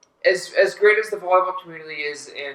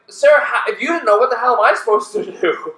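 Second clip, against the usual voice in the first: retold exasperation; 6 semitones higher, 3 dB louder than usual. Retold exasperated retort to Sarah.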